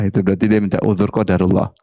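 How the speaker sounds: ordinary delivery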